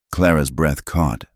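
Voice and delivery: ordinary delivery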